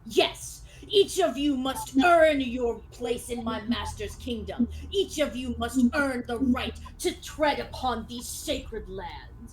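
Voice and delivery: ringing formal challenge; grand, declamatory knight; proclaiming knight. Acted. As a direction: lay down the law like a proud duelist. Game master plays the skeleton knight.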